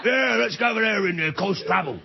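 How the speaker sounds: mock Cockney orc. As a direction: bark it like a cartoon Cockney orc. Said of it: "they all talk like cockneys".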